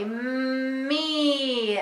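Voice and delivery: "Me" as a very long mmm sliding into a long eee; slowly. Shown as a demonstration. Teacher correcting her demo, "Me" drawn right out. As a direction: stretch every sound in "Me" without stopping. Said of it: "so you say it slowly".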